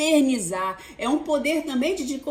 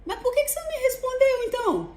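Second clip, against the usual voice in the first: high, fast indignant reply; exasperated retort; 9 semitones higher than usual. Retold or imitated. Mother retorting to daughter, high and exasperated. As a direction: fire back, fed up. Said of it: "Eu falei".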